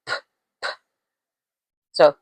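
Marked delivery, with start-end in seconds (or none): 0.1-0.7 "p, p" as two tiny voiceless lip pops; P sound. Shown as a demonstration. Teacher showing the P sound, P sound. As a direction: pop each "p" with no vowel. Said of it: "P sound".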